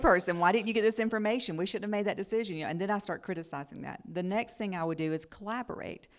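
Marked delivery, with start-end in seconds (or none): 0.0-2.6 loud, high scolding questions; critical scolding. Retold or imitated. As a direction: nag him, louder and higher. Wife acts out critiquing husband.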